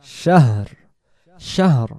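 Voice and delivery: শাহর with a breathy h before r; শাহর said as shahr with a clear h. Shown as a demonstration. Teacher shows students how to say শাহর. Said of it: "শাহর এমন হবে".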